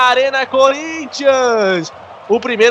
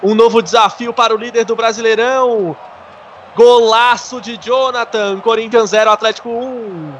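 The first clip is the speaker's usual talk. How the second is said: high, slow, drawn-out goal call; explosive goal commentary; 6 semitones higher than usual. Real, not acted. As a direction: keep the goal euphoria going.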